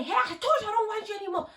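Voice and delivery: high, fast imitated yell at her; mock shout. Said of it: "he's like".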